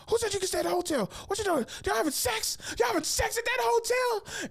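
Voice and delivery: fast, screechy high interrogation; imitated freaking-out mom. Mom grilling her son about the hotel, frantic high imitation. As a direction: mimic an outraged mother in falsetto. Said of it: "Then my mom freaking out".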